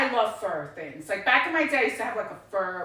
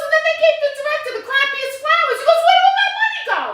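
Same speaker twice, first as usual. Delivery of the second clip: high, loud, fast indignant complaint; mock outrage over cheap flowers; outraged rant; 7 dB louder, much faster than usual. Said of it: "And he goes".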